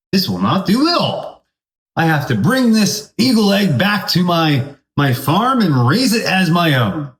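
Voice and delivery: lifted, fussy farmer; a fussy farmer pitched well up; high farmer voice. Acted. Farmer voiced in a told story.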